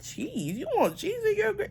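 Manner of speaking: mocking a staff member, squeaky high disbelief; high, incredulous mimic of the waiter; flabbergasted mock waiter. Mocking the restaurant staff asking about cheese, shrill disbelief. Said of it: "they was acting so flabbergasted".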